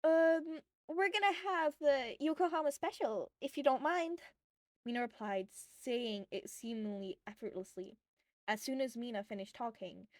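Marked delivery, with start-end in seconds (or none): 0.0-4.2 put-on higher, louder nervous girl voice; nervous order. A narrator's reading. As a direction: blurt the code order, a bit jittery. Mina nervously ordering a secret drink.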